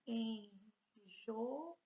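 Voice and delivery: enjoa split en, jo, a with pauses; syllable by syllable. Shown as a demonstration. Teacher proving three syllables to students, enjoa broken apart. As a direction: count out three parts of enjoa. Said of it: "Vejam que nós temos três".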